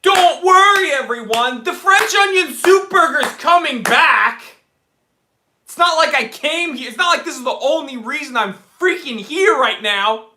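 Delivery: hammed-up loud, high outraged rant; mock outburst. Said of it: "And I was like".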